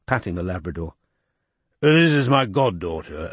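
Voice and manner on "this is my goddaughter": lightly voiced irritable older man; gruff politician. A narrator's reading. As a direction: grumble like an annoyed minister. Chisel voiced in story.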